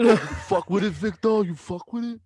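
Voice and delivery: very high, loud mock excitement; high-pitched goofy pestering; hammed-up hyping. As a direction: push the joke up into a squeal. Hammed-up repeat of quote, friends laughing.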